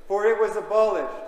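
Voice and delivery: ordinary delivery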